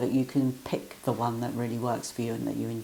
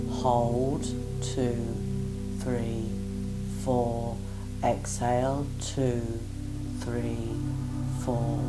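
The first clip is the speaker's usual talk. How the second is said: guided breath counting; much slower than usual. Acted. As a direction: count each breath evenly and calmly.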